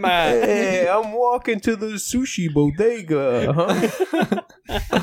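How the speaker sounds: high, hammed-up New Yorker; mock New York accent. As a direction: call it out like a caricature New Yorker. Playing a New Yorker passing by.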